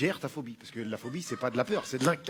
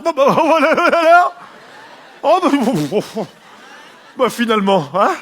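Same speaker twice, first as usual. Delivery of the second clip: giddy surprised voice; 5 semitones higher, 10 dB louder than usual. Acted. Homophobe surprised enjoying it.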